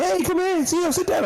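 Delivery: very high, sing-song host welcome; mock the overly eager restaurant greeter; imitating chirpy staff.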